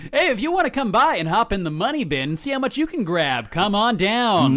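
high-pitched DJ come-on; radio promo hype. Pretend. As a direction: sell it like a radio giveaway. Mock radio promo patter.

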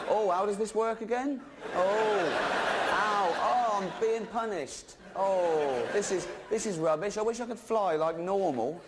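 high, whingeing mock moan; whining snake. Snake complaining about crawling, high whiny moaning.

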